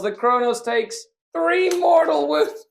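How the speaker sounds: high, drawn-out wail of disbelief; dramatic dismay. Real, not acted. Player watching his Kronos get hurt, loud high dismay. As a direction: announce the damage in loud, rising outrage. Dismay at failed leadership test.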